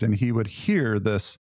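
ordinary talk, nothing marked

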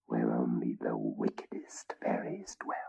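soft whispered dread; storyteller whispering of wicked fairies; ominous whisper. A narrator's reading. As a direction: hush it like a dark secret. Ominous narration.

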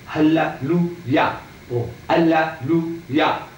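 hallelujah slowly split into halla-lu-ya; slow syllable breakdown. Shown as a demonstration. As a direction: stretch hallelujah out chunk by chunk. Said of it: "You hear the words?".

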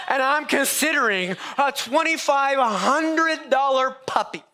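high, slow disbelief at his own folly; laughing at how close he came; incredulous at himself. Real, not acted. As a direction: stretch it out, amazed at yourself.

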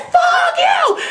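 very high, loud yell straight back; shrieking retort. Retold or imitated. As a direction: fire it right back at him. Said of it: "I said".

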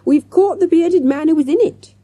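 put-on excited child, high and loud; crying out. A narrator's reading. Girl announcing the capture, crying out. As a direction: call it out high and triumphant. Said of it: "cried Jessica".

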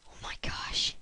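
whispered surprise. Real, not acted.

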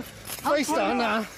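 drawn-out shouted order; slow shouted command. Real, not acted. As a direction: stretch every word of the order. Officer orders suspect down.

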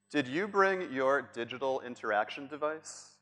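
ordinary delivery

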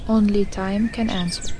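ordinary delivery

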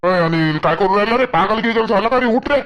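loud scolding, climbing high; alarmed shouting. Acted. Neighbour breaks up Anil's beating, shouting urgently. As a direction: yell at him to let her go at once.

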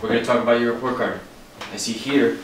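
ordinary talk, nothing marked

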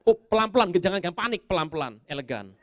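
loud, high self-talk in comic panic; mock panic. Unready preacher steadying himself before the sermon, mock panic. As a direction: urgently tell yourself to keep cool.

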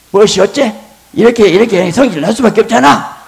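high, loud indignant outburst; husband's angry retort. Retold or imitated. Angry husband shouting back at his wife. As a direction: explode with hurt indignation.

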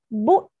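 "Boots" with a crisp final t then s; both final sounds pronounced. Shown as a demonstration. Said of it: "chúng ta sẽ có hai âm cuối".

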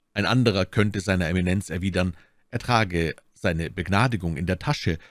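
ordinary delivery, nothing marked

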